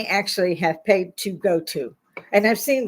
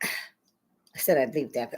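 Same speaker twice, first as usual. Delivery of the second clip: weary sigh; 8 semitones lower, 5 dB quieter, slower than usual. Real, not acted.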